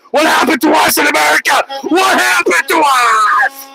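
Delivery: rising to a high, wailing shout; carried away, excited. Pretend. Streamer ranting to viewers about lost strength, carried-away shouting. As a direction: build from emphatic praise to a hammed-up anguished yell. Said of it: "Got a little carried away".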